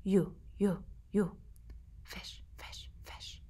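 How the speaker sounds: soft unvoiced chant; whisper. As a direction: whisper the magic word to the stone. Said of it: "They whispered to it".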